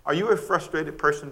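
ordinary talk

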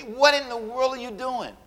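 slow, high, disbelieving question; mock-stunned slow plea; incredulous. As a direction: draw it out in disbelief. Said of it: "stand there and say".

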